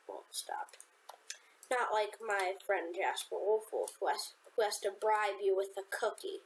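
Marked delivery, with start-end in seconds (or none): 0.1-0.8 low breathy whisper. Pretend. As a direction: whisper it defiantly. Whispered defiant aside.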